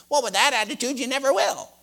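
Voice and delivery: high, loud mocking imitation of her; mimicking her tone. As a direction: throw her own whiny tone back at her. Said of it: "he mimicked her tone of voice and said".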